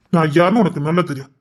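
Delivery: ordinary delivery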